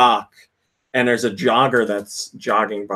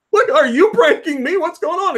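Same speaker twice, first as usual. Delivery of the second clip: much higher, mock incredulous; baffled jogger. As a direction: play the bewildered jogger who's being pranked. Confused jogger in retold prank.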